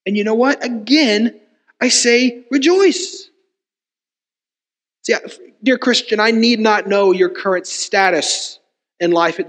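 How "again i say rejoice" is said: slow, raised REJOICE, drawn out; emphatic. Retold or imitated. Preacher quoting the Apostle Paul to dear Christians, emphatic. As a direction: lift the pitch and stretch out "rejoice". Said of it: "he can emphatically say this".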